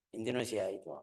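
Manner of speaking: ordinary talk, nothing marked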